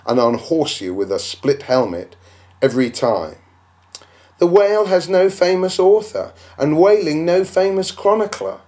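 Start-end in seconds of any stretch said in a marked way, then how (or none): 4.4-8.6 higher, scoffing voice of the doubter; mock the skeptic who says it; scornful imagined critic; objector's voice. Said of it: "you will say".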